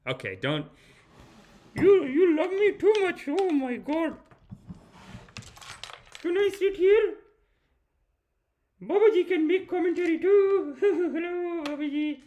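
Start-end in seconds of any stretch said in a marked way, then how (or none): 1.7-12.2 very high, loud cartoonish sidekick voice; squeaky sidekick Babaji. Sidekick cutting in on the host's show, very high put-on voice. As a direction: pipe up as a pushy little comic sidekick. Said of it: "I love the sidekick".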